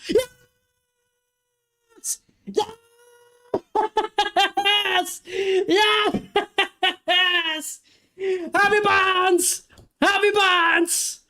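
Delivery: high, stretched-out screams of joy; ecstatic goal celebration. Real, not acted. Fan watching his team score against Barcelona, ecstatic screaming. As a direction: lose it completely as the ball goes in.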